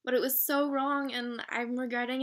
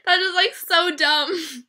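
giddy loud; 4 semitones higher, 14 dB louder, slower than usual. Real, not acted.

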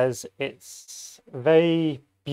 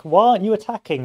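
higher, louder indignant demand; French ally snapping at the British, higher and louder; 7 semitones higher, 5 dB louder than usual. Retold or imitated. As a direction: demand why they're not attacking, pitched up and loud. Voicing French demanding attack.

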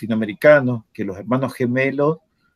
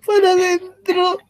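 laughing; 3 dB louder than usual. Real, not acted. Laughing while telling it.

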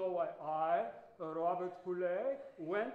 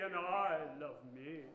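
ordinary delivery

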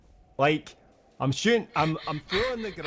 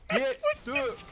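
much higher squeaky taunt; squeaky get stuck. Pretend. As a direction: squeak it out to tease. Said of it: "I can't fucking concentrate".

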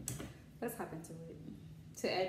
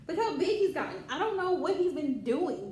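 higher, louder amazed exclaim; 6 semitones higher, 6 dB louder than usual. Real, not acted. Showing viewers her grown bunny, amazed exclaim. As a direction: marvel at how big he got.

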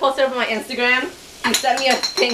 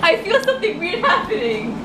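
laughing; 10 semitones higher than usual. Real, not acted. Laughing, panicked.

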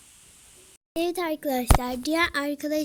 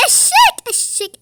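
loud taunt; 14 semitones higher, 9 dB louder than usual. Real, not acted.

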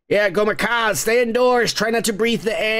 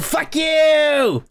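angry shout; 8 semitones higher than usual. Real, not acted. Furious rant at TikTok muting.

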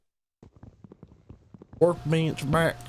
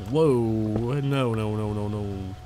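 southern accent; much slower than usual. Shown as a demonstration. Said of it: "I'll just talk in this, a southern accent".

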